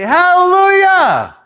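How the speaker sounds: high loud drawn-out praise; joyful shout. Retold or imitated. Worshipper bursting out in praise at church, loud and high. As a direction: cry out in worship. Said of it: "I could just jump up for joy".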